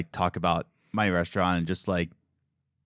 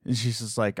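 ordinary talk, nothing marked

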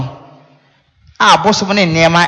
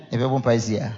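unvoiced whispered aside; whispering; 6 semitones lower, 9 dB quieter, faster than usual. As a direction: drop to a whisper. Aside in retold story.

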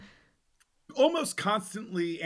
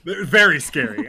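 usual speaking voice

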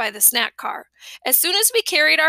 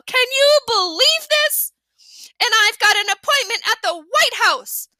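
furious woman; 9 semitones higher, 5 dB louder than usual. A narrator's reading. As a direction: scold in furious disbelief. Angry woman in story.

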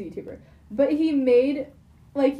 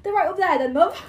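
answering in pitched-up British put-on; mock British accent; 5 semitones higher than usual. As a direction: play along in jokey British. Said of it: "everyone in my family has started doing British accents".

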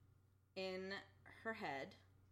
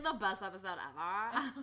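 sarcastic; 7 dB louder than usual. Real, not acted. Sarcastic praise, laughing.

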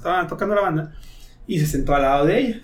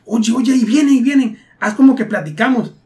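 urgent mock warning; 6 semitones higher, 4 dB louder than usual. Friend urgently whispering warning.

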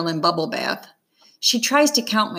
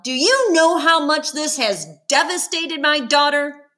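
higher, near-shouting accusation; almost shouting; 9 semitones higher than usual. A narrator's reading. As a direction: nearly yell at the coach. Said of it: "She's almost shouting now".

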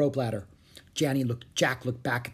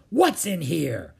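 slow, raised pirate voice; demanding pirate; 4 semitones higher, 3 dB louder, slower than usual. Acted. Captain Bones grabbing Jack's backpack, demanding pirate. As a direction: demand it with menace.

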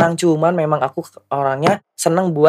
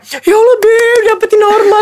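much higher squealing praise; sassy drag queen; 5 dB louder than usual. Retold or imitated. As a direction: squeal like a gushing friend. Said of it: "teman-teman kesekongan gue itu kayak".

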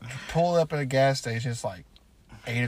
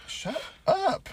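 telling off the dog. Retold or imitated. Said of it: "I'm like".

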